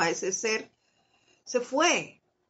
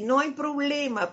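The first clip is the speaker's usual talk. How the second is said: a higher, lightly forced "No hay problema"; fake easygoing; 6 semitones higher than usual. Shown as a demonstration. Brushing off the friend who broke the cup, mock casual. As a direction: say it's fine while not meaning it. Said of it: "pero uno lo dice así".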